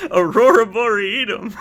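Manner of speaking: loud, high, helpless laughter in the words; laughing at your own silly word; cracking up. Real, not acted. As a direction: barely get it out for laughing. Cracking up over the pronunciation.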